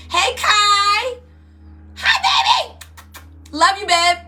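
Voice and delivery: loud, high, sing-out hello to a loved one; calling out a greeting. Real, not acted. Greeting someone who just appeared.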